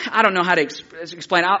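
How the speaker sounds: ordinary delivery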